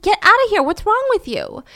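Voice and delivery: high-pitched mock outrage; indignant. Friend ordering the husband out, indignant. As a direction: imitate an exasperated order to leave.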